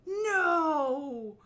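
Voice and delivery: crying out in real distress, loud; anguished shout. Real, not acted. Reacting to a shocking anime scene, anguished cry. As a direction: shout no in horror at the screen.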